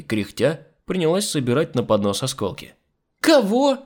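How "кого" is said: high, loud startled question. A narrator's reading. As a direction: jump at what you heard. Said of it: "подскочила маменька". (изумлённый высокий возглас)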